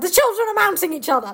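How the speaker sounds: hammed-up alarm, high and fast; shout-like mock panic with laughter creeping in; Aslan. Friend parodying Aslan alerting everyone, high laughing alarm. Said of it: "shouted Aslan".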